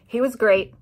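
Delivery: ordinary talk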